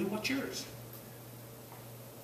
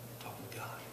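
whispered, unvoiced, to the child; 18 dB quieter than usual. Parent whispering to a child mid-prayer, whispered. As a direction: hush it so God isn't interrupted.

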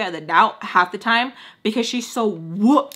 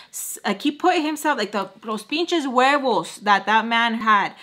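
usual speaking voice

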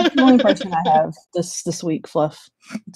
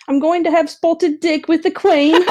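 higher, hammed-up British, amused; mock British accent; 7 semitones higher than usual. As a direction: play a silly Brit visiting royalty. Mock British role play.

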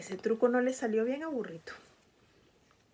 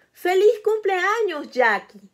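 louder, higher, drawn-out happy greeting; cheerful birthday greeting; 8 semitones higher, 6 dB louder, slower than usual. Acted. Mamá Burra greets Jackie at the party, louder and cheerful. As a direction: call out the birthday wish warmly. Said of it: "saluda Mamá Burra".

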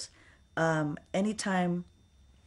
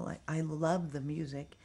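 ordinary talk, nothing marked

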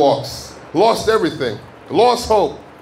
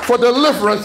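higher-pitched, emphatic sermon delivery; fervent preaching; 5 semitones higher than usual. Real, not acted. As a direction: proclaim it to the congregation. Preaches at peak.

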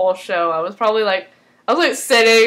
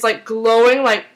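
ordinary talk, nothing marked

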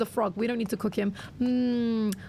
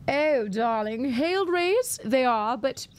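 mock-British socialite lilt; British accent. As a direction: play it British, a hammed-up "darling". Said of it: "I'm doing my British accent".

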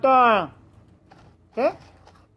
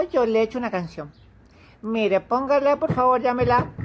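ordinary delivery

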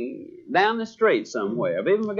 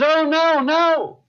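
high-pitched salesman's protest; imitating a salesman backing off; hasty mock denial; 11 semitones higher, 3 dB louder, slower than usual. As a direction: deny it in a raised, higher voice. Salesman acted denying he is selling.

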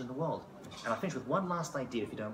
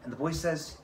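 usual speaking voice

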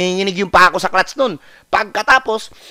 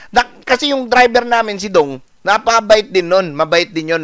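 usual speaking voice

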